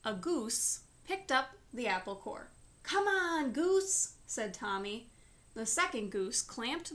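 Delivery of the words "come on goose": put-on high, calling voice of a boy. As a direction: call the goose like an eager kid.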